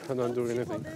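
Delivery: quiet mumbled repeat to himself; low grumble, barely voiced; muttered under breath. Real, not acted.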